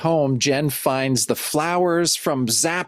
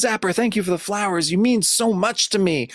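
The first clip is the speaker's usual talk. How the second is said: higher, lightly voiced girlish thanks; excited Jen; 7 semitones higher than usual. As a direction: gush it pitched up like the heroine.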